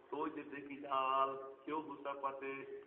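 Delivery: ordinary delivery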